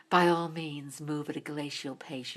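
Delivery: low, quiet, slow icy drawl; a hint of a chilly fashion editor; movie quote. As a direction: deliver it coldly like a stern boss. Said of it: "her lines in the movie".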